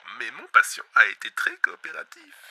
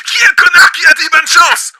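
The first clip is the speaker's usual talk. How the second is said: high, very loud outburst; furious shout; 18 dB louder than usual. Acted. Host exploding at whoever wished him luck. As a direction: explode at the listener.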